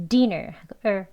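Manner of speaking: normal talking voice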